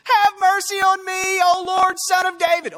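high, sustained screamed cry; screaming plea. Acted. Desperate woman calling after Jesus, screaming. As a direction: scream it out on one high pitch. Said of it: "this woman screaming".

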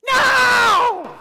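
huge high shout of no; preacher's explosive shout. Acted. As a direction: blast the word out at full force. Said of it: "Verse two".

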